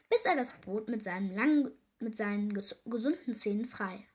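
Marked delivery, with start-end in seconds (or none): none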